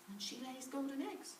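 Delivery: ordinary delivery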